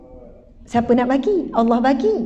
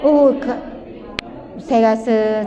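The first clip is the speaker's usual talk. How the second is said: normal talking voice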